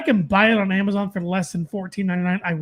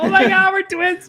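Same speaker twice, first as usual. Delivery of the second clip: excited; 9 semitones higher, 5 dB louder than usual. Pretend. Mock excitement at saying the same thing.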